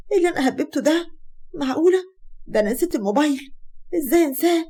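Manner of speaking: high-pitched exclamations of dismay; dismayed self-reproach. Acted. Asmaa realises she left her mobile at home, dismayed. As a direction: scold yourself for a silly slip.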